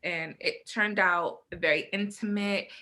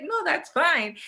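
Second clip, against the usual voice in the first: laughing; 9 semitones higher, 4 dB louder than usual. Retold or imitated. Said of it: "I was like".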